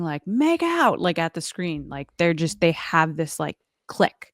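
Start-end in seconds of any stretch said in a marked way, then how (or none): 0.3-1.0 high-pitched playful mock yell; mock scream. Viewer urging an on-screen couple to kiss, mock scream. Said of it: "you're just screaming".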